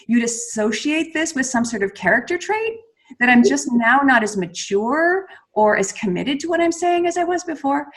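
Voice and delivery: every phrase ends rising up, like asking; upward inflections. Shown as a demonstration. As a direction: lift the end of each statement into a question. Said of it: "the only thing I changed there was an inflection".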